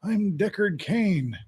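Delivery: Deckard Cain impression. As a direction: play the wise old storyteller, slowly. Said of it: "Deckard Cain sorta had this kind of a voice".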